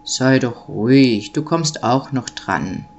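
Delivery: low, slow hushing voice; Nikolaus-like man. A narrator's reading. Man tells the impatient child to wait, low and slow. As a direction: hush the child in a deep, slow voice. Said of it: "klang so ähnlich wie die Stimme des Nikolaus".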